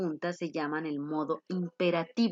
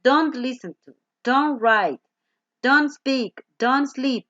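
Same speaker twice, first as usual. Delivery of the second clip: don't punched louder at each start; stress on don't; 4 semitones higher, 5 dB louder, slower than usual. Shown as a demonstration. Teacher drilling don't commands to students. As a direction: hit every don't hard. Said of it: "Única y exclusivamente don't".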